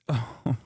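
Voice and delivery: a quick chuckle at his own joke; short laugh. Real, not acted. Streamer laughing at his own bit to chat, short chuckle.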